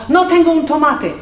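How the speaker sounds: high, rapid-fire Spanish example; said fast. Shown as a demonstration. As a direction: toss it off at speed. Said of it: "Say it fast".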